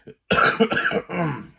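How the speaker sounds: sick, chesty cough. Real, not acted. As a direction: cough hard from a cold. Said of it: "There it happened again".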